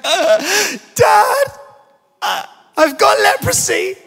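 loud, high-pitched wailing child impression; mock bawling like a panicked little daughter; hammed-up hysterical sobbing. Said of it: "burst into hysterical floods of tears".

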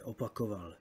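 usual speaking voice

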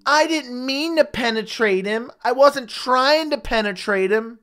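sneering imitation of Weidman pleading innocence; mocking Chris Weidman. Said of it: "had the audacity to say".

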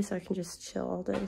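ordinary talk, nothing marked

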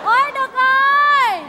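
slow loud drawn-out call; hollering for Đực from afar. Acted. Woman calling her partner, slow loud holler.

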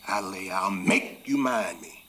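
loud, slow angry threat; boiling mad. Acted. Father boiling mad at his disobedient child, threatening. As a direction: threaten the child, leaning hard on the words. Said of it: "I got boiling mad".

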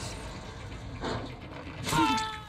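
high unvoiced giggle; real airy laugh; breathy laugh. Real, not acted. Laughs at his bad luck.

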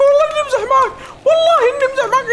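very high, loud, laughing plea; laughing panicked plea. Real, not acted. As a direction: beg the monster through laughter. Pleading with monster, laughing. (توسل ضاحك بصوت عالٍ جداً)

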